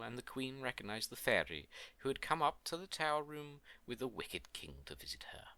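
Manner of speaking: usual speaking voice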